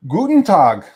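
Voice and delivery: loud, high German hello; hammed-up German greeting.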